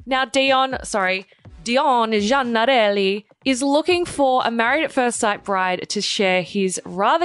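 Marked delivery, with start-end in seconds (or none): 1.6-3.2 Italian accent on the name. Shown as a demonstration. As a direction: draw the name out in an Italian lilt. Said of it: "say his name like that every single time".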